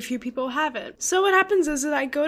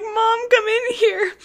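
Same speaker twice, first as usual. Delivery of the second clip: retold crying yell; 8 semitones higher, 5 dB louder than usual. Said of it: "And I start crying, yelling for my mom".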